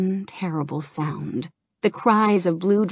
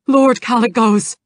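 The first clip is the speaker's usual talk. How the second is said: loud, higher panicked call of a name; frantic cry; 6 semitones higher, 9 dB louder than usual. Acted. Naragos calling to Kalecgos in panic, loud cry. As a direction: call out to your lord in panic. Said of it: "Naragos cried".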